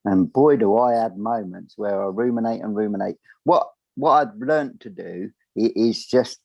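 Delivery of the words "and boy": ordinary talk, nothing marked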